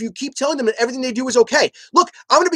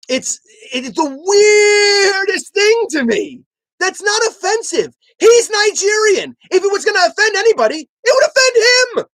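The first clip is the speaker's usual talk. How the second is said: exasperated shout; 9 semitones higher, 4 dB louder than usual. Real, not acted. Frustrated rant about accent offense.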